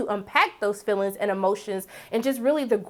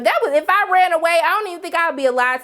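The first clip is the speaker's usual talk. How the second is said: emphatic; 7 semitones higher, 4 dB louder, faster than usual. Real, not acted. Strict parents rant.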